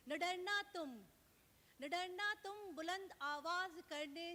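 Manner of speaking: rousing mushaira declamation, rising on the exhortation; lifted, ringing verse delivery; Urdu nazm recital. Acted. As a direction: recite it as a rallying poem, then settle on the refrain. Poet recites her nazm.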